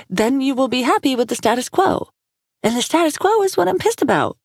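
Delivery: exasperated woman venting angrily; angry woman's voice. Acted. Furious woman snapping at him.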